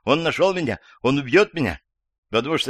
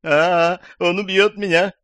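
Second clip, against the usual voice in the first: high, loud wail of terror; terrified cry; 9 semitones higher, 5 dB louder than usual. A narrator's reading. Old woman hears the attic door while phoning, terrified cry. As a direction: cry out high, in fear for your life.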